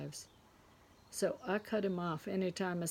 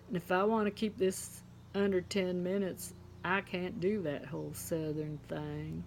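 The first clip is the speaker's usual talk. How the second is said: usual speaking voice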